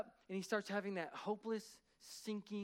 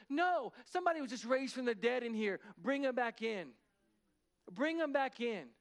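raised, forceful correction; retold minister's insistent call, pitched up; emphatic order; 4 semitones higher, 4 dB louder than usual. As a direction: overrule them with a ringing command. Minister overrules panicking pastors.

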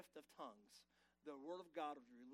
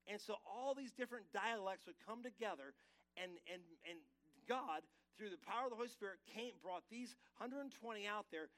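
louder, rising preacher drive; preaching; 4 semitones higher, 6 dB louder than usual. Acted. Pastor preaching Pentecost to his church, louder and driving. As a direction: build the point with growing force.

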